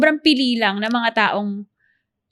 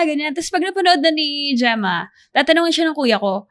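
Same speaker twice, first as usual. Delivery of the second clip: ordinary talk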